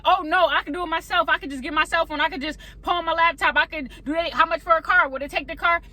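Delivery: high, insistent self-reliance; frantic refusal. Pretend. People refusing God's paid debt, high and insistent. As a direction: keep pushing back the gift, voice lifted. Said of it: "people are like".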